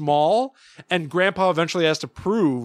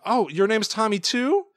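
put-on higher, delighted toddler voice; raised voice, rising question; cartoon baby Tommy; 7 semitones higher than usual. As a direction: ask like a fooled little kid. Voicing baby Tommy to the parrot.

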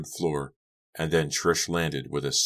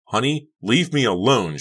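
high, loud furious cry; pitched-up, losing control; angry shriek; 8 semitones higher, 6 dB louder than usual. A narrator's reading. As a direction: shriek at her to back off. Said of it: "she shrieked".

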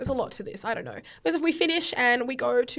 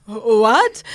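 confused dad; 11 dB louder than usual. Retold or imitated. Dad's confused reply.

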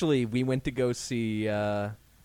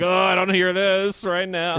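mock oblivious; 5 semitones higher, 5 dB louder, slower than usual.

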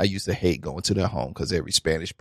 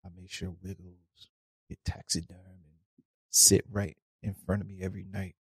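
solemn vow; 10 dB quieter than usual. Acted. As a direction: promise it in a hushed voice. Acted solemn vow over dead kitten.

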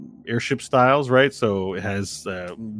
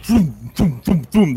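repeated percussive booms; imitated cannon fire; 4 semitones higher, 6 dB louder, slower than usual. Game master imitating cannonballs firing, punchy booms. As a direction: puff out each boom like a cannon.

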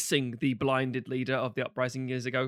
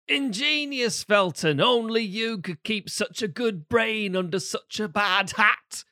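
high, slow hammed-up posh villain voice; mock posh wizard drawl; quoted film line; 7 semitones higher, slower than usual. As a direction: sneer it out like a pantomime villain. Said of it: "here's a line from the film".

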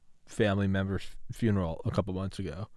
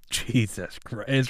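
slow, drawn-out stunned "Jesus Christ"; mock-appalled friend, slowed right down; 3 dB quieter, much slower than usual. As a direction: let it out slowly like a shocked buddy. Friends react to shocking joke.

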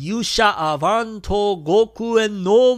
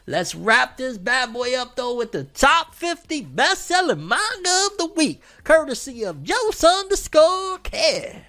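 hyped announcer; 8 semitones higher than usual. Real, not acted. Hype announcer read of chart.

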